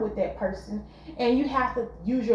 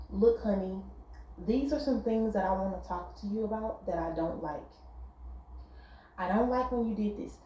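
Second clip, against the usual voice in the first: softer, lower-pitched calm complaint; 2 semitones lower, 7 dB quieter than usual. Shown as a demonstration. Showing how to calmly raise a complaint with a partner, soft low voice. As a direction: keep it low and soft, no heat. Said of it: "You can keep your tone low".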